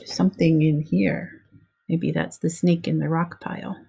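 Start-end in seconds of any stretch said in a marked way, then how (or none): none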